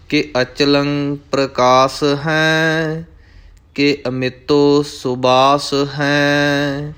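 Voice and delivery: slow, sustained devotional chant; scripture recitation. Acted. Teacher reciting scripture for learners, slow chanting. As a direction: recite measuredly, drawing out each line's last syllables.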